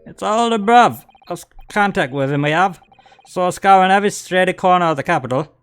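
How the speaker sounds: high Cockney explaining voice; Cockney crook. Acted. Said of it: "they are Cockney".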